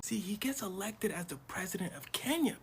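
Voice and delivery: ordinary delivery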